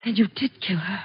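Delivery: a breathless whisper of sudden realization; horrified whisper. Acted. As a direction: whisper it, frozen with dread. Realizing he is the killer.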